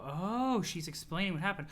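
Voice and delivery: ordinary talk, nothing marked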